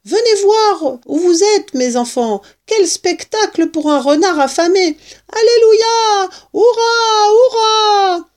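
high, loud excited calling building to cheers; joyful shouting. A narrator's reading. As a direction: call everyone over, then whoop in celebration. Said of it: "cria-t-il".

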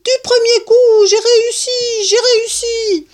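very high, loud triumphant whooping; elated, sustained high-pitched yelling; enthusiastic yell. A narrator's reading. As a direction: shout your success to the rooftops. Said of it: "hurla-t-il".

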